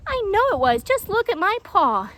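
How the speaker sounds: indignant complaint. Acted. Hedgehog complains.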